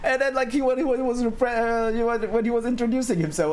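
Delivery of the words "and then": usual speaking voice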